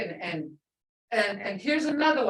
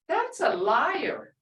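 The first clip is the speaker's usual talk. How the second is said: ordinary talk, nothing marked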